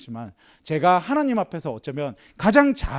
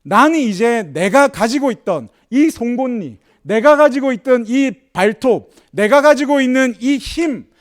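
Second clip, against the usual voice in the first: slow, much higher and louder declaration; solemn proclamation; 7 semitones higher, 7 dB louder, slower than usual. Acted. Preacher voicing the lion's vow, a slow loud proclamation.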